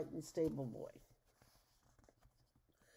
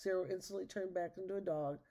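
plain reading voice

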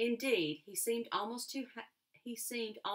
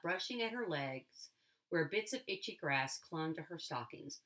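ordinary delivery, nothing marked

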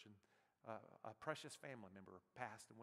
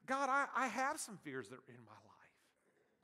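confessing prayer; 5 semitones higher, 10 dB louder, slower than usual. Pretend. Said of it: "We gotta say".

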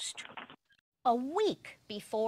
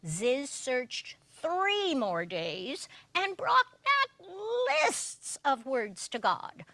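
ordinary delivery, nothing marked

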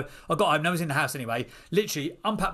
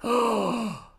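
stunned oh; 8 semitones higher than usual. Retold or imitated. Stunned by blunt business advice.

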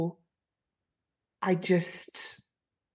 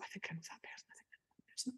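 unvoiced whisper to herself; 23 dB quieter, much faster than usual. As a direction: whisper it under your breath. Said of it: "I just go".

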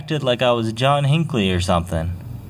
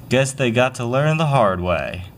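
ordinary talk, nothing marked

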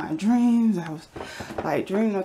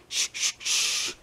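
razor cutting sound. Retold or imitated. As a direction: mimic the razor blade cutting down the wall, hushed and breathy. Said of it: "went like this".

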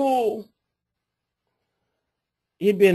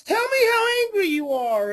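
much higher, crying shout from the mother; mom shouting; 13 semitones higher than usual. Retold or imitated. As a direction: shout it like the mom demanding his anger. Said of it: "and mom says".

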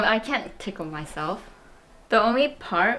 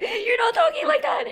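squeaky mocking echo of her sister; mocking high voice. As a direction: parrot it back high and silly. No, you're not talking like that.

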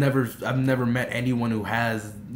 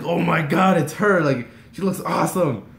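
higher, louder starstruck gush; reliving fan excitement, voice lifted; excited gushing; 6 semitones higher, 5 dB louder than usual. Retold or imitated. Fan gushing on seeing idol.

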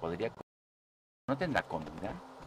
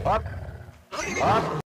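urgent shouting; 11 semitones higher, 6 dB louder, much slower than usual. Real, not acted. Fleeing wolf, urging horse on.

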